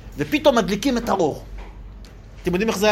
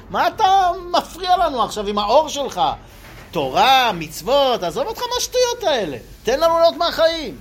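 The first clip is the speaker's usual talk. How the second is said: a much higher, indignant mock complaint; annoyed brush-off; 7 semitones higher than usual. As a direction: wave off the man who turns on the light. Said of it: "ומה אנחנו אומרים לו?".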